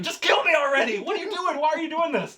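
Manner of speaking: high-pitched mock panic; freaking out. Random guy held at home by Joker, acted freaking out. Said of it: "freaking out".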